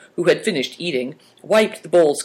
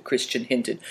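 usual speaking voice

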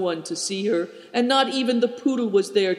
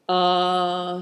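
long, sighed exclamation; sighing. A narrator's reading. Gloomy companion musing on what can't be known, sighing. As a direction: let it out as a level sigh. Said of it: "sighed Affliction".

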